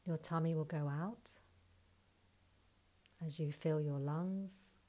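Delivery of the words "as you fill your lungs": normal talking voice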